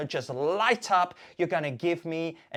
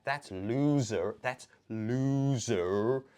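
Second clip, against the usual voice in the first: slow, stretched mock jeer; drawn-out taunt; 4 semitones lower, much slower than usual. Mocking the loser hand sign.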